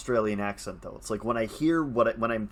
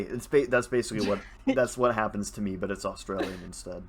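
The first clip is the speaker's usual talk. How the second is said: ordinary talk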